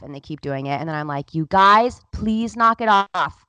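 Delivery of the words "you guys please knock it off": loud, higher insistent appeal; retold exasperated plea. Mother to boys who keep teasing, exasperated pleading. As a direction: plead loudly, stretching the words out.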